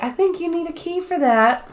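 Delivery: high-pitched imitation of Evie; prim Evie. Imitating Evie needling the Egyptologist, high-pitched character voice. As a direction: pitch it up, lightly teasing.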